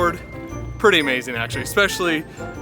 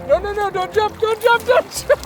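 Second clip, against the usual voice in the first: high alarmed no no no; urgent pleading; 13 semitones higher than usual. Real, not acted. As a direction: beg the dog not to jump. Dog about to jump.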